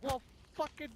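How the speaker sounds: very high, exasperated cry; retold exasperated outburst. Player reenacting exasperated insult at rival, very high pitched. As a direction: throw up your hands at him. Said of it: "I'm like".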